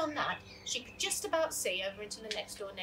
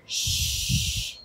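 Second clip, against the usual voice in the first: whispered parrot shh, louder; a parrot copying a hush; breathy mimicked shh; parrot shushing; 8 dB louder than usual. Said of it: "Nacho murmured to himself".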